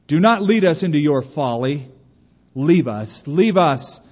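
loud, stern storyteller's rebuke; crying out protest. A narrator's reading. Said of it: "cried one of the cave dwellers".

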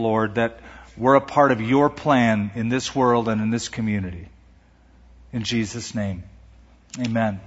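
earnest, low pulpit prayer. Real, not acted. Pastor prays over congregation.

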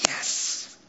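a triumphant whisper, almost silent; whispered yes. Acted. Nehemiah hearing the door open, whispered. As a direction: breathe a secret yes.

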